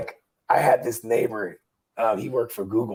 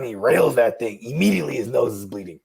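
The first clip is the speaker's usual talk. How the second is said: ordinary talk, nothing marked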